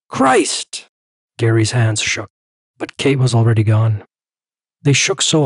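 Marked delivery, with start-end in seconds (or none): 0.1-0.9 exasperated cry, high; exasperated outburst. Acted. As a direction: blurt it out in frustration. Gary exasperated exclamation.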